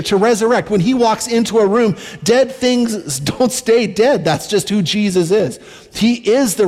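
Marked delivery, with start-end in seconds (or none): 2.2-4.2 slowed emphasis. Shown as a demonstration.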